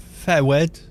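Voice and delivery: Fewed said fe-wed, two short syllables; Fewed, Welsh-accented forward; Welsh-style forward. Shown as a demonstration. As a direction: clip forward to Fewed like a Cardiff local. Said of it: "they say Fewed in English".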